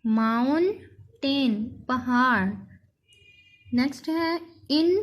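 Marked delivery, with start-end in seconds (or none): 0.1-1.8 slow mountain with a gap before "टेन"; split into syllables. Shown as a demonstration. Teacher repeating for pupils, mountain slow and split. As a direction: say mountain as two separate beats. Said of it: "इसके अलग-अलग".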